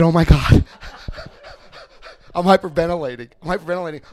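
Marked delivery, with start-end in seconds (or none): 0.0-0.6 panicked oh my God. Retold or imitated. Panicking at the table.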